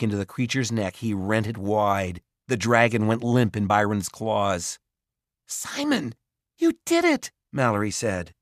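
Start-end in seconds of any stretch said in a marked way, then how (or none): 5.5-7.3 high, bright burst of joy; excited cheer. A narrator's reading. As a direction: cheer him in relief. Cheers after the dragon dies.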